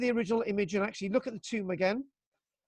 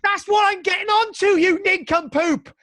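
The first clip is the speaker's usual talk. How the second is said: exasperated outburst; 9 semitones higher, 6 dB louder than usual. Real, not acted. Teacher snaps at heckling pupil.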